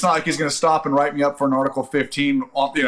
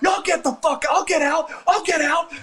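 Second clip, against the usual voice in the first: very high, exasperated retort; retold fed-up driver's yell; angry yelling back. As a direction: snap back at your commander, voice way up. Acted driver yelling back at commander.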